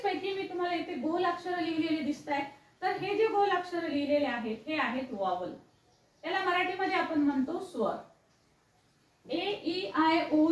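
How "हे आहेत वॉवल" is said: ordinary talk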